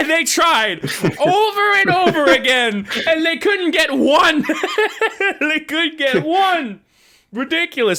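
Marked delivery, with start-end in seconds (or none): 0.0-6.8 laughing. Real, not acted. Mocking Superman game attempts.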